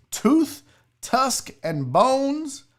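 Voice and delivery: ordinary talk, nothing marked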